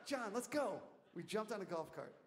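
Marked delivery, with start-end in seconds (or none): 0.1-0.8 high, urgent rallying call; rally someone to move now; acted call to action; retold urgent command. Calls his colleague to rush off.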